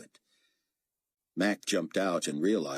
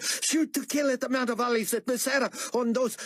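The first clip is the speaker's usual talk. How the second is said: running words together; rushed words; 3 dB louder than usual. A narrator's reading. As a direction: blurt orders in a rush. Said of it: "spoke so quickly that his words ran together".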